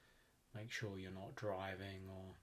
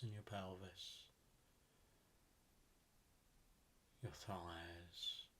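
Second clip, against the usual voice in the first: guided meditation; 8 dB quieter than usual. Acted. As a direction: ease them down into relaxation, unhurried and even.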